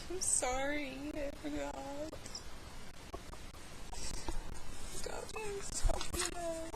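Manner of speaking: mock crying. Apologizing for losing the topic, mock crying. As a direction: pretend to tear up, soft and high. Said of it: "Not really crying".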